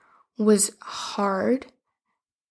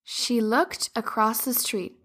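ordinary delivery, nothing marked

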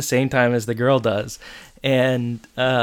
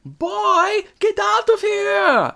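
high, drawn-out mock holler; yelled from downstairs; much slower than usual. Woman downstairs ordering a boy out, shouting. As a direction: yell it high and stretch the words. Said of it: "from downstairs you hear".

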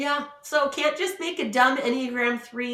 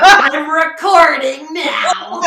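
slow, much higher and louder, laughing through it; laughing husband; 6 semitones higher, 11 dB louder, much slower than usual. Retold or imitated. Husband gleefully recording the news, laughing slowly. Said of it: "He's like".

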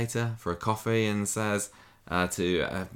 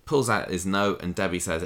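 usual speaking voice

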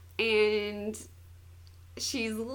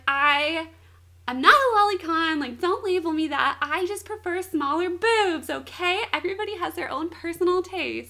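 loud, raised-pitch indignant protest; offended; 5 semitones higher, 7 dB louder than usual. Retold or imitated. Man answering her lolicon accusation, mock offended. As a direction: act out his wounded self-defense, emphatic and insistent. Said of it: "he takes it very personally".

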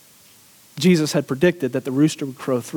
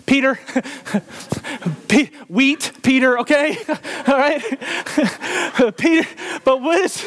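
laughing; 8 semitones higher than usual. Real, not acted. Preacher cracks up at own slip.

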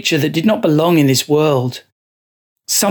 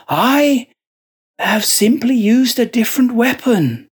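eerie monk voice; 7 semitones higher than usual. A narrator's reading. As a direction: preach it like a possessed monk. Joseph justifies himself.

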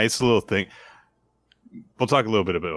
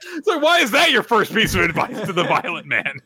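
laughing; 12 semitones higher than usual. Real, not acted. Laughing at the joke.

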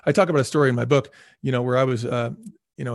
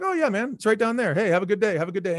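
much higher, cheery friendly voice; sunny and helpful; friendly guy; 6 semitones higher than usual. Retold or imitated. Guy on porch wishes him a good day, cheerful. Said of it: "it was like".

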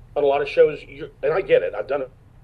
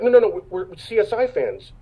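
higher, louder string of no's; retold eager correction; 7 semitones higher, 4 dB louder than usual. Actor imitates CSI fans correcting him, eager raised voice. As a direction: jump in to clear up the mistake.

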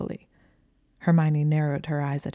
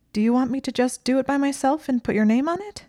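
high, loud and shrill, rising at the end; shrill question; 9 semitones higher, 4 dB louder than usual. A narrator's reading. Hermione asks Malfoy about doing the work alone, shrill. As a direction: ask it in a shrill, raised pitch. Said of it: "asked in a shrill voice".

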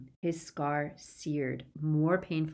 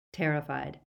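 ordinary delivery

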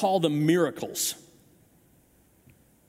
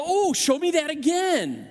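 eager onlooker; 7 semitones higher than usual. Retold or imitated. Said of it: "and you go".

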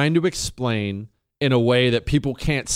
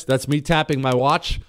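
ordinary talk

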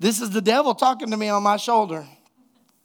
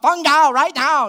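loud, high barked fake order; mock police shout; 8 semitones higher, 8 dB louder than usual. Prankster posing as police yelling through a door, loud mock order.

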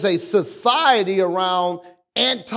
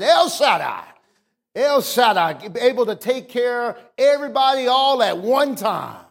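higher, impassioned pulpit proclamation; full-force preaching; 6 semitones higher than usual. Real, not acted. Preacher proclaims God as provider to the congregation, preaching at full force. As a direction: proclaim it to the congregation, drawing out key words.

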